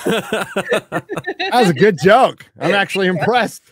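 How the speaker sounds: high, loud words through laughter; laughing hard. Real, not acted. Host reacting to co-host's joke, laughing. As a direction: crack up while praising the joke.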